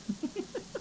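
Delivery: very high, soft squeal of a laugh; high squeaky laugh. Real, not acted. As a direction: giggle at your own joke. Laughs at her own dumb joke.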